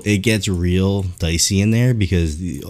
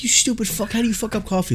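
imitated irate customer; 13 semitones higher, 4 dB quieter than usual. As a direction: scold in a high put-on voice. Imitating customers yelling at staff.

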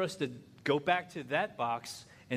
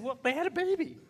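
much higher, helpless reply; clueless husband; 3 dB louder than usual. Retold or imitated. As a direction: offer the bare minimum. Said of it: "I said".

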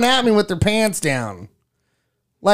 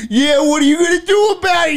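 high shouted Boston taunt; Boston tough guy; 11 semitones higher, 4 dB louder, faster than usual. Retold or imitated. Other guy taunts back, shouting in a Boston accent. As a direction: taunt him like a Boston wiseguy. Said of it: "your ridiculous voices".

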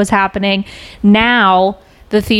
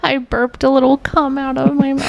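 higher mimicry of a meek little voice; mocking imitation; 5 semitones higher than usual. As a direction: parrot it back in a silly voice. Mimics the other speaker's character line.

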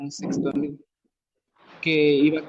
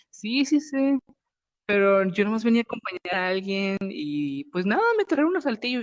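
much higher put-on girly voice; imitating the girl; 4 semitones higher than usual. Girl explaining she was brought to Saltillo, high imitated voice. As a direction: play the lost girl shrugging it off up high.

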